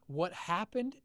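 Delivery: usual speaking voice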